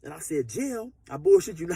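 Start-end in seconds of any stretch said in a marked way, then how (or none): 0.5-0.9 dazed disbelief. Retold or imitated.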